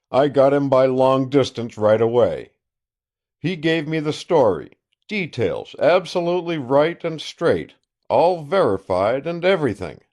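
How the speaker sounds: loud, boastful country sheriff on the phone; a gloating sheriff calling an old friend; triumphant sheriff. Acted. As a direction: crow over the news like a self-satisfied lawman.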